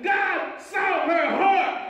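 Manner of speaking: high, slow, drawn-out proclamation; preacher's shout. Real, not acted. Preacher revealing why Sue was chosen, triumphant shout. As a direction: proclaim it at full force. Climax of the story, then repeated.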